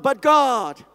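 loud, ringing declaration. Real, not acted. Preacher urging everyone to say it loud, ringing shout. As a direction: proclaim it with full force. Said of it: "Everybody say loud".

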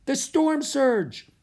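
high, loud scream, slowing at the end; screaming in alarm. A narrator's reading. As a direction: scream a terrified warning. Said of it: "Annie screamed".

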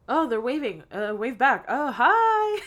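lightly acted politicians, rising to a high greeting; mock-polite onlookers, ending high and loud; imitated onlookers. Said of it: "the senator and the congressman being like".

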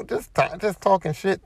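ordinary delivery

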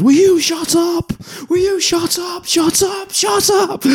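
high, slow falsetto screaming. Retold or imitated. Tony screams at the priest to shut up, shrill falsetto. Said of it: "screams at him".

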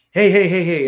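loud, high run of heys; acting out cutting in to stop someone; retold protest. Retold protest to angry homeowner.

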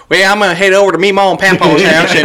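Charlie. Pretend. Imagining a friend heading to the grandparents' for dinner, Southern twang. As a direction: parody a country twang with gusto. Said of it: "imagining Charlie being like".

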